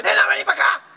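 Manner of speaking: high-pitched imitated motherly yell. Performer mimics a child's mother, yelling for her. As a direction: mimic a mum calling her girl over. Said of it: "¿Tu mamá no te grita acaso? Te dice".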